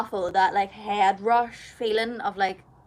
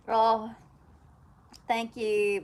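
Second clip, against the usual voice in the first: ordinary talk, nothing marked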